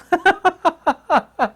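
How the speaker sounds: high, loud burst of laughter. Real, not acted. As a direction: laugh out loud at your own joke. Laughs at own joke.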